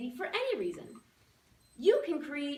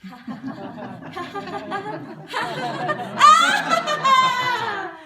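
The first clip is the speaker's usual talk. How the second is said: building laugh; 7 semitones higher, 11 dB louder, slower than usual. Shown as a demonstration. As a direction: laugh growing from normal to very loud and high. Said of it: "The more confident you get".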